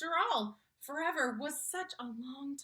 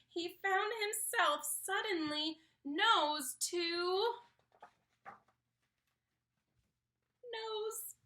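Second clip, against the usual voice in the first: slow, rising, higher to the final nose; suspenseful slowdown; 8 semitones higher, slower than usual. A narrator's reading. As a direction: stretch the tension to the last word. Tense close-up moment.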